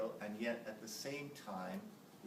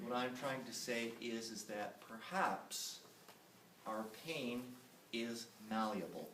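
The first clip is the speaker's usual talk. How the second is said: normal talking voice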